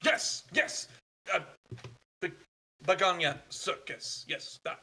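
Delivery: a hint of German, delighted wunderbar; grateful stranger, eager and quick; relieved foreign traveler.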